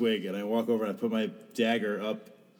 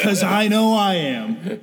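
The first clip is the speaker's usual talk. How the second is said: high, loud, drawn-out challenge; hammed-up fighting shout; battle yell; 8 semitones higher, 7 dB louder, much slower than usual. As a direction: yell it slowly like a reckless dare. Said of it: "Germ just yelled".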